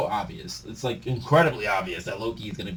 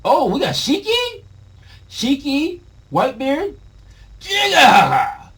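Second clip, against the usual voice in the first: much higher, loud thrilled reaction; hyped; 9 dB louder, slower than usual. Real, not acted. Reader spotting Shiki and Whitebeard, excited shout. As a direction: hype it up.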